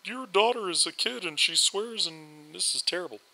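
a viewer scolding the dad for swearing, mock outrage; mock outraged viewer. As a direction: voice a scandalized commenter, hammed up. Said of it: "people who are, are livid".

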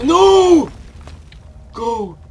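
high loud frantic cries; frantic shouting. Real, not acted. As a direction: scream through the narrow escape. Dramatic escape attempt.

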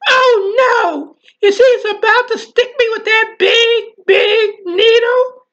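high, loud panicked "oh no", slowing on "big needle"; a child's frightened outburst at the needle; terrified cry. Retold or imitated. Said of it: "I was terrified".

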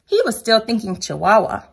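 plain reading voice

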